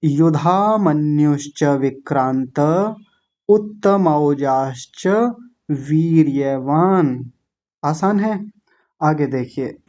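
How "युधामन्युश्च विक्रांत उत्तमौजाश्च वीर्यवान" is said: words of the verse said slowly and evenly; teacher's slow verse demo; slow recitation. Shown as a demonstration. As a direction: recite the line slowly for learners. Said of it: "इतना जल्दी जल्दी हो जाएंगे".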